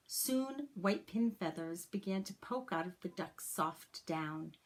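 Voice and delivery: put-on gentle, expressive read-aloud for children; story narration. A narrator's reading. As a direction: read it like a teacher sharing a picture book with the class. Reads a picture book to class.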